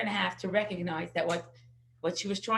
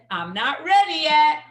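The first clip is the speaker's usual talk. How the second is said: put-on child's retort; 5 semitones higher, 10 dB louder than usual. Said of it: "And he says".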